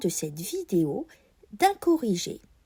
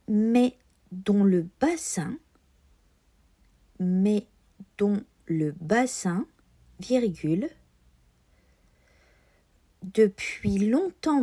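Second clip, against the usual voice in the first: dictée. Shown as a demonstration. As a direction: lecture lente de dictée, ponctuation dite. Dictée read slowly with punctuation. (voix de dictée scolaire)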